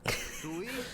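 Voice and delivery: soft high giggle; a quick breathy laugh at the fight. Real, not acted. Laughing softly as the characters start fighting.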